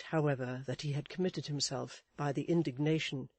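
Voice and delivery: ordinary delivery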